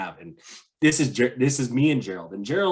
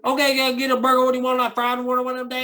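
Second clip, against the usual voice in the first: high, loud, fast put-on Southern accent; hammed-up bayou cook rattling off orders; Southern twang; 13 semitones higher, 6 dB louder, faster than usual. Bayou server pressing a confused visitor, in a high Southern twang. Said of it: "that Southern twang".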